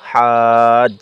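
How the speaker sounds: drawn-out recitation of হাজ; long madd. Shown as a demonstration. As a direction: stretch হাজ for four counts. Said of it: "উপরে মোটা চিহ্ন".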